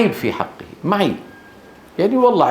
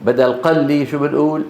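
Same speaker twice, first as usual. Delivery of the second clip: usual speaking voice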